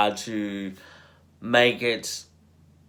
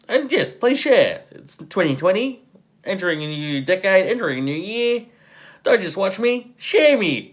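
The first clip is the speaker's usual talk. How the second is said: hyped up; 8 semitones higher than usual. Real, not acted. Hyped outro plea.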